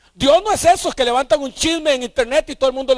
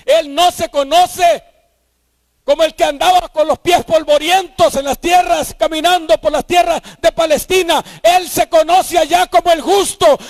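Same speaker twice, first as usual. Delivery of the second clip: rising sermon cry, louder with every phrase; preaching at full force; 6 semitones higher, 6 dB louder than usual. Acted. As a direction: climb until you are thundering from the pulpit. Preacher building to a roar.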